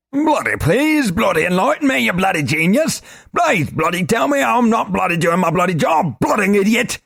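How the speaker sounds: high, loud mocking tirade; sarcastic rant. Globin mocking Korash's idea, high sarcastic shout. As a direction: sneer it out, dripping scorn.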